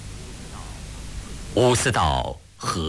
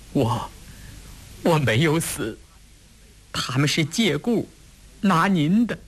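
higher-pitched, halting, on the edge of tears; choked up; 8 semitones higher than usual. A narrator's reading. Woman tells Wu she never died, choking up. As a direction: catch on the first word, holding back tears. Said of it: "哽咽着说".

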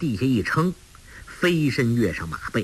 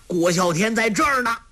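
higher, proud challenge; bold shout; 10 semitones higher, faster than usual. Acted. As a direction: announce yourself defiantly. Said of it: "喝道".

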